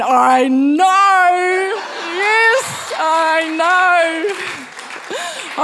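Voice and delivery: overjoyed yell of agreement; excited shout. Retold or imitated. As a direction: burst out happily, almost singing it. Retold joy at dad's confirmation.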